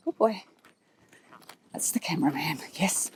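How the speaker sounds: a soft whisper to calm him; whispered reassurance. Real, not acted. Rider whispering to her horse that it's only the cameraman. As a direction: hush him about the cameraman.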